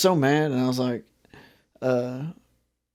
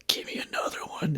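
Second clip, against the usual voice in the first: hushed, whispered imitation; whispered request; 12 dB quieter, faster than usual. Customer who dropped milk asks clerk, whispering. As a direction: whisper it like a sheepish secret.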